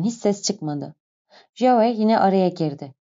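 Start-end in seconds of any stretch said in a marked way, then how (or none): none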